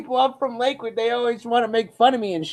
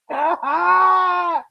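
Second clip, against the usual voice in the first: high exaggerated fake bawling; mock sob; 6 semitones higher than usual. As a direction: burst into pretend wailing. Mock sobbing at a hat.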